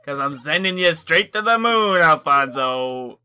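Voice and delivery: playful villain bellow sending him to the moon; mock threat shout. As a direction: yell the threat like a cartoon villain.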